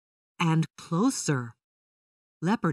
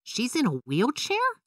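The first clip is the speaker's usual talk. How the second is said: put-on surprised child; 7 semitones higher than usual. A narrator's reading. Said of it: "Violet asked".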